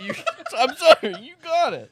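breaking into loud laughter; cracking up mid-line; laughing. Real, not acted. Laughing while in role.

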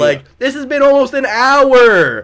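high, loud, drawn-out complaint; slow down and bellow the grievance; retold outraged yelling. Storyteller berating his friend about the long wait, outraged. Acted outburst at Ben.

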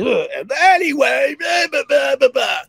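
high, shouty mock blabbering; obnoxious loud guy. Loud train passenger ignoring my brother, mock shouty blabber. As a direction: imitate a loudmouth yammering over everyone. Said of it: "And, and the guy's like".

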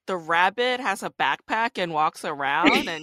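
half-joking indignant questioning; playful incredulity. Real, not acted. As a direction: challenge an absurd complaint, amused. Mock outrage at the reviewer's complaint.

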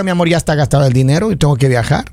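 pretend husband's excuse. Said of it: "usted compre el boleto y le diga".

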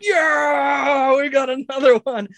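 high, strained cheering voice; whooping, drawn-out high cheer; huge crowd cheer. Acted. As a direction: imitate a crowd hailing a new challenger. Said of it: "there's this huge cheer".

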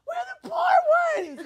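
high, loud, slow outraged yell; shouting. Retold or imitated. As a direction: cry it out loud in protest. Complaining about rich friends.

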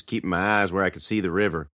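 usual speaking voice

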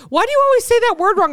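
high, loud, fast mock nagging; hammed-up exasperated correction; mocking imitation. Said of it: "making fun of me".

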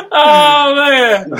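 loud high laughing cry; laughing hard. Real, not acted. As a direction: crack up loudly. Howling after the repent joke.

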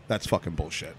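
low, quiet flat dismissal; gruff chef. Retold or imitated. Chef dismissing drunk friend's claim, low quiet dismissal. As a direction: mutter it deadpan low. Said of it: "he goes".

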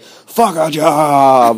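very slow, tough rapper voice; slow rapper drawl. Retold or imitated. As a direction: lay it out slow and cocky. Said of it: "videos where he's like".

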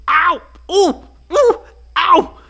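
high, loud yelps of pain. Acted. Ryan bitten by red ants, yelping in pain. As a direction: yelp as the ants bite.

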